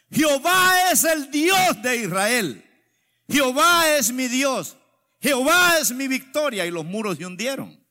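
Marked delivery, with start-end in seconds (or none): 0.1-6.7 loud, high proclamation with drawn-out words; cries of victory. Acted. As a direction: proclaim it like an army shouting victory. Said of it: "fueron gritos de victoria". (gritos de victoria)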